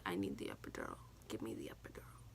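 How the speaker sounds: low, soft begging that drops to a whisper; pained plea. Retold or imitated. Mother in labor begging nurses for the epidural, pained whisper. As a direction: beg for relief under your breath.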